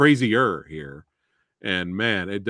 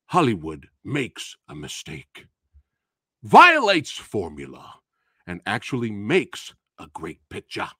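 slow, lower formal reading; reading a review; 4 semitones lower than usual. Shown as a demonstration. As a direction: read it like a solemn critic. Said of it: "Can you read that last, that, that quote one more time".

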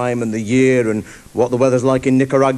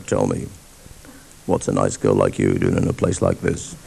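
low smooth chat-up voice; 5 dB quieter than usual.

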